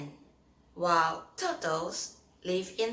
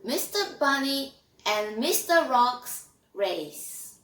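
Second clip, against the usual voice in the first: put-on 1 story reading for kids; 6 dB louder than usual. Reads a story to children.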